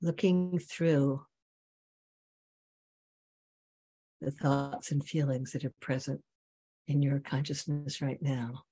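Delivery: slow meditation voice with long pauses; guided meditation. Real, not acted. Teacher bringing students back to the breath, guided meditation. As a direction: guide them gently inward, leaving long silences.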